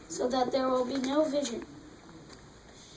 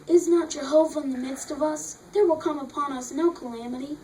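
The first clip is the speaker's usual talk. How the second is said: slightly changed voice, louder, like someone talking; conversational quote; 6 dB louder than usual. Acted. Reader voicing the people's boast in scripture, conversational and louder. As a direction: switch from narration into a spoken boast. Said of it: "then you change your voice just a little bit, and then you said it like someone having a conversation".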